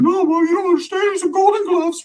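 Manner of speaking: put-on very high, whiny kid's wail; mock crying. Scared kid pleading with mom not to make him fight, mock crying. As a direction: imitate a tearful child pleading. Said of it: "I was crying like".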